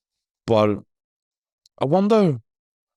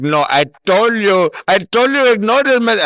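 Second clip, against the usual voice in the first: a lightly voiced call-centre woman, louder and higher; mock scam caller; 7 semitones higher, 5 dB louder than usual. Scammer insisting to the caller on the phone, lightly mocked. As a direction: insist like a pushy phone scammer, half-mocking. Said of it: "She was like".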